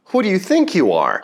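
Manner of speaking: "Who do you think you are" run together, quick and high; copied TV line. Retold or imitated. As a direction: roll "Who do you think you are" out in one fast, high-pitched twist. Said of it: "I really liked how the tongue twisted".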